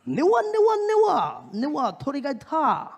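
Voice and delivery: very high, loud mock Japanese; loud high mock Japanese chant; strong Japanese. Shown as a demonstration. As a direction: blurt fake Japanese loudly. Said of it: "I could also say".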